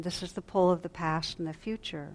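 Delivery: ordinary talk, nothing marked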